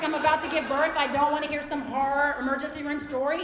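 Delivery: ordinary talk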